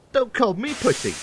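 high-pitched squabbling retort; indignant retort. A narrator's reading. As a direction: bicker back at your twin. Twins quarrel in the story.

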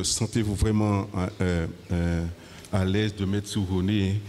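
ordinary talk, nothing marked

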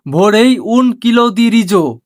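very slow, word by word Italian phrase; slow teaching demo. Shown as a demonstration. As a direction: spell it out slowly for learners. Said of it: "Italian শব্দে বলে". (ধীরে ধীরে শেখানোর উচ্চারণ)

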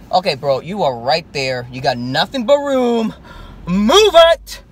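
road rage. Real, not acted. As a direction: shout at the car in front. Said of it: "I'm sorry, road rage".

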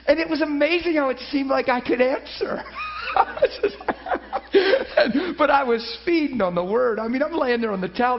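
loud, laughing through the words; laughing joy. Real, not acted. Preacher marvelling at answering questions, laughing aloud. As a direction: burst into joyful laughter mid-sentence.

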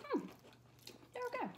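muffled by food; chewing while talking. Real, not acted. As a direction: mouth partly full. Seen 1: Middle adult bites pretzel at -3, appears chewing with closed lips throughout.

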